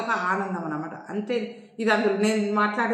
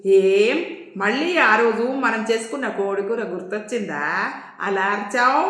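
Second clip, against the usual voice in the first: plain reading voice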